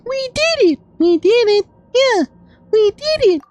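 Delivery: very high, squeaky put-on cheer; high-pitched playful victory squeal; squeaky celebration. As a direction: cheer in a tiny, piping mock voice. Celebrating the boss win.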